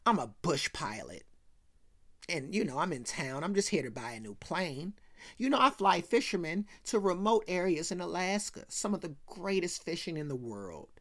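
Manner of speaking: low, soft, smooth patter; lightly voiced visitor, understated and low; [name]'s cover story. [name] lies to Harriet about his job, lightly played low-key patter.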